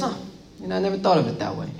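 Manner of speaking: put-on quick offhand Brooklyn Italian accent. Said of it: "It's my Italian accent for all you, my, my Brooklyn".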